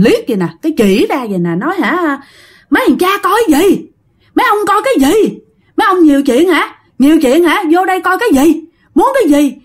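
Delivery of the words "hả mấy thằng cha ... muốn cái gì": higher, harsh ghostly scolding; fierce scolding. Retold or imitated. Dead girl yelling at the staring men, fierce. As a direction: snap at the nosy men. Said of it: "cái mặt hung dữ lên".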